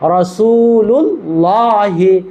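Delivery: রাসূলুল্লাহি chanted very slowly, drawn out; model pronunciation. Shown as a demonstration. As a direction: stretch রাসূলুল্লাহি slowly with a thick la. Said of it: "কি উচ্চারণ?".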